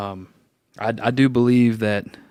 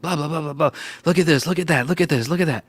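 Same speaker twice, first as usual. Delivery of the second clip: mock excited blabbing, higher; all excited; 4 semitones higher, 4 dB quieter, faster than usual. Blabbing at lunch to a coworker about biblical cosmology, mock excited. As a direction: rattle it off like an overeager kid at lunch. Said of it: "And getting all excited".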